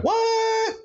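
high squeaked what; shocked at his girl still being mad. Retold or imitated. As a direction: pop way up in disbelief. Shocked reaction in retold scene.

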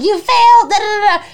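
loud high scolding at herself; scolding herself, loud and high with a laughing cry; self-berating. Retold or imitated. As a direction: tear into yourself, loud. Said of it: "berating myself".

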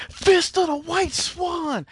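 high, drawn-out battle cry; hammed-up cartoon fighter yell; Moose. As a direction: shout it like an anime attack call. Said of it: "as Moose going".

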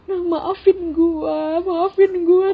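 high, crying, slow apology; tearful apology. Retold or imitated. Asti hugging her and apologising, high and tearful. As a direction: sob out the sorry while hugging. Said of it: "dia meluk gue kayak".

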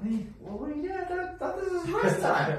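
high-pitched mock complaint; imitated high-voiced protest, slowing at the end; protesting trainee. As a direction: mimic a baffled trainee, pitch climbing sharply. Imitates winded client complaining in drill.

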